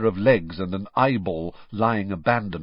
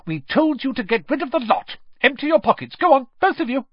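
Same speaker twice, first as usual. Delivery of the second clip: higher, louder angry scolding; furious; 6 dB louder than usual. Acted. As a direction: keep tearing into them. Mother scolding the twins.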